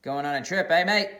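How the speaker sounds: a mock-suspicious mate; sly Mickey. Mickey catches Xander leaving.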